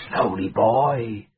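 ordinary delivery

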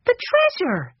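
put-on high, thrilled boy's exclamation; a small boy spotting the treasure; excited cry. A narrator's reading. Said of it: "Benny cried".

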